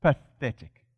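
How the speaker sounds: a low, scornful "Pathetic"; contemptuous dismissal. Retold or imitated. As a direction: sneer it down at the devil. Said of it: "look at the devil and go".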